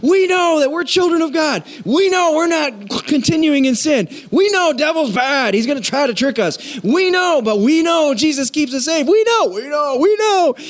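high, eager, breathless listing; John excitedly writing his letter. Retold or imitated. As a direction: gush each we know with delight. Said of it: "And he's all excited".

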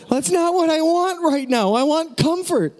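high-pitched imitation of an upset child; protesting child. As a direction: insist like a child demanding comfort. Exasperated child protests to dad.

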